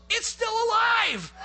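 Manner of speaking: high, drawn-out alarmed shout; yelling. Retold or imitated. Partner finding the turkey alive, retold loud yell. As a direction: holler it slowly across the river. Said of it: "He yells".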